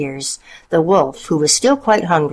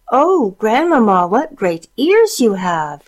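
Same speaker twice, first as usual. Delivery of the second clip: put-on wondering girl; 5 semitones higher, 3 dB louder than usual. A narrator's reading. Girl asks again.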